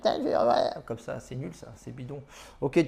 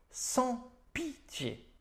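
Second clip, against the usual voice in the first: drawn-out high catchphrase; 12 semitones higher, 8 dB quieter, much slower than usual. Acted. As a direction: stretch "Sans pitié" very slowly on a much higher pitch.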